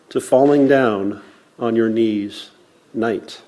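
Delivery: usual speaking voice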